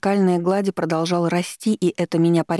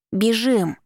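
high, urgent mild cry; understated narrated shout; shouted command; 5 semitones higher than usual. A narrator's reading. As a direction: call out to flee, without real volume. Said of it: "крикнув".

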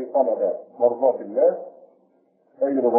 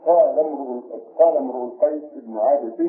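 ordinary talk

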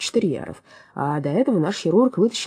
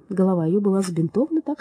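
plain reading voice

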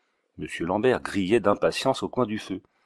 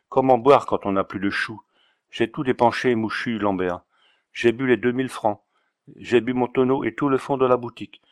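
lightly voiced Auvergnat drunk, s turned to ch. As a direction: whine it like a broke Auvergnat sot. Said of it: "avec son aimable accent".

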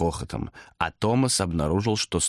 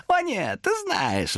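high sly demon voice; demon objecting; much slower than usual. A narrator's reading. Demon contradicts Thomas. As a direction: contradict him slyly. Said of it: "возразил демон".

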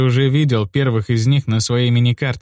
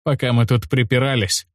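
ordinary delivery

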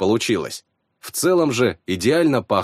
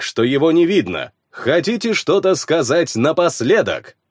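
loud, high arena announcer; loud soapbox ranting; 5 semitones higher than usual. Acted. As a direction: hold the crowd like a herald. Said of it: "вещал с трибуны глашатай".

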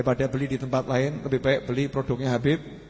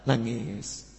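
ordinary delivery

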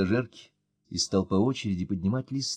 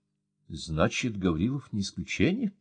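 usual speaking voice

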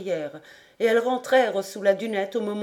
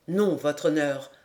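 lower-pitched, firm refusal; 5 semitones lower than usual. A narrator's reading. Captain refuses the lord's wish to stay, firm. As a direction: refuse respectfully but firmly. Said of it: "d'une voix ferme".

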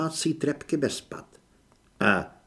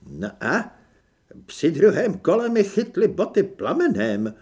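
flustered; 6 semitones higher, 5 dB louder than usual. Acted. Flustered explanation of burning shoes.

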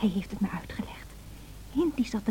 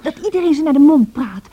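indignant outburst; 2 semitones higher, 8 dB louder than usual. Acted. Cassie flares up about spoiled children.

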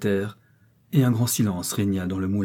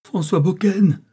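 high, loud urgent call; cried out; 9 semitones higher, 7 dB louder, slower than usual. A narrator's reading. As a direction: try to rouse him with a cry. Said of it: "cria le garçon meunier en le secouant".

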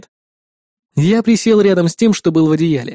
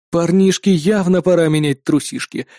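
ordinary delivery, nothing marked

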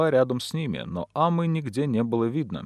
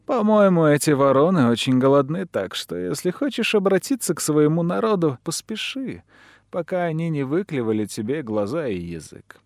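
cruel taunt; 4 semitones higher, 5 dB louder than usual. A narrator's reading. Villain taunts the condemned man.

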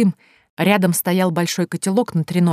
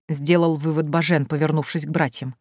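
plain reading voice

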